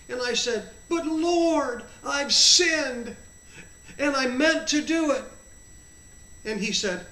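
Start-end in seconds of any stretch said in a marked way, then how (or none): 0.9-5.2 loud, high pleading confession; anguished protest. Retold or imitated. Sinner confesses to the Lord, anguished protest. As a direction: plead it, higher and louder, drawing out the words.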